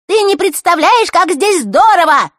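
put-on high, loud squeaky girly voice. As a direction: squeak like a silly girl. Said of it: "песклявым голосом".

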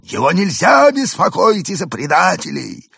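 high stern alien voice. A narrator's reading. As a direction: scold in an alien voice. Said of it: "строго ответил он". (строгий голос пришельца)

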